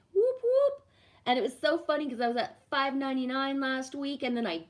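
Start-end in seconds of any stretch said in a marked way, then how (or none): 0.1-0.9 high, loud celebratory whoop; excited cheer. Real, not acted. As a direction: cheer it like a little party. Celebrating hitting six hundred.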